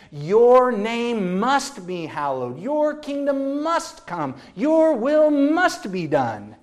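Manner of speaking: strong, deliberate proclamation building then settling; emphatic scripture reading. Acted. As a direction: declare every line as a command. Emphatic rendering of the prayer.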